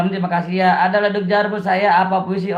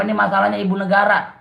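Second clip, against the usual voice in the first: ordinary talk, nothing marked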